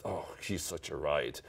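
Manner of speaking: low, soft, slow aside; under the breath. Retold or imitated. Passers-by admiring him as they walk past, under their breath. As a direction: murmur it as imitated passers-by. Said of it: "say under their breath".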